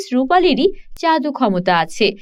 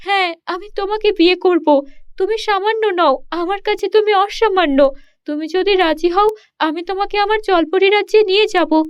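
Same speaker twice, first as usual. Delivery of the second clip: put-on higher, sweet-toned young mermaid voice, pleading; mermaid princess; 6 semitones higher than usual. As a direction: coax the shepherd into marrying her. Mermaid insists on marrying. (উঁচু গলায় আবদার করা জলপরি)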